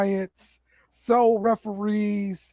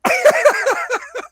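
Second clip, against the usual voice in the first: loud, high burst of laughter; big laugh; 5 dB louder than usual. Real, not acted. Laughs at co-host's jab.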